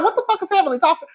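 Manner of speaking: high, fast incredulous squeal; baffled. Retold or imitated. Host explaining the random apology, retelling her high-pitched bafflement. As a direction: fire it off high in comic disbelief. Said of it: "That's why I was like".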